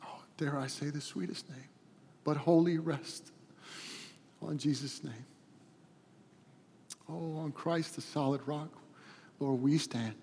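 soft, halting prayer with long pauses; hushed, heartfelt prayer; closing prayer. Real, not acted. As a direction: pray quietly and reverently to God.

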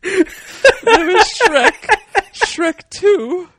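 each title slow, loud and high; slow emphatic listing. Real, not acted. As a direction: spell them out slowly, laughing.

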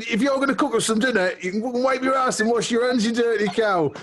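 high, hammed-up telling-off; mock outraged scolding. Telling the mum to wash her hands before cooking, high mock rant. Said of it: "I said".

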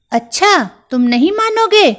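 high, loud mouse threat, rising at each line end; a furious little mouse vowing revenge; angry threat. Acted.

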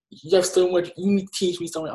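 high-pitched mock pleading; lightly mocking high voice; imitated plea. Repeats the girl's plea mockingly.

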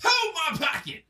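high-pitched comedic catchphrase; stand-up bit imitation. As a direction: echo the comedian's line up high. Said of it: "did you see the one, the stand-up".

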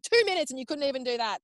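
high-pitched retold outburst of indignation; aggressive. Labouring mother berating obstetrician for getting sidetracked, aggressive. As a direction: act out scolding in exasperation. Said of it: "I'm never aggressive, but I was like".

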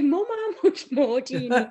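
high, loud laughing jab at mum; laughing retort. Retold or imitated. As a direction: laugh it out, teasing your mum. Teasing mum about sign.

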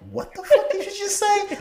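put-on high, startled little girl voice; cousin's shocked reply. Cousin shocked at uncle's words, high girlish squeal. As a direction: squeal it like a stunned kid. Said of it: "And she was like".

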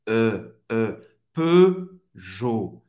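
"E" as French ø, then "Peugeot" as pø-JO; rounded closed E. Shown as a demonstration. Teacher demonstrating the French E-U sound, rounded closed E. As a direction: round lips for O while saying "E", as in "Peugeot". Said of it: "faz a boca do O e fala E".

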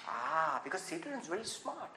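ordinary delivery

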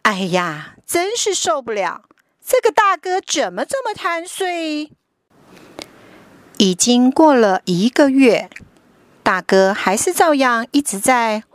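put-on lively storybook narration for kids; reading to a toddler. A narrator's reading. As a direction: read it like a bedtime picture book. Picture book read to children.

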